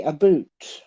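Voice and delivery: "a boot" said with a long oo for "about"; mock Canadian "about". Canadian quoting what Americans claim they say, "a boot" with long oo. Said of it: "Would they say we say".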